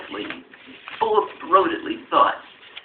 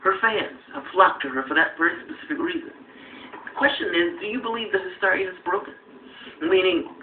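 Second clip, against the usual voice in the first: ordinary delivery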